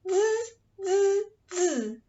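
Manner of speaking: high buzzing monkey sound; monkey chatter. Shown as a demonstration. Storyteller showing children the monkey sound, high buzz. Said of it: "Can you do that?".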